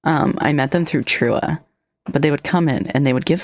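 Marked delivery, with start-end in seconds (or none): none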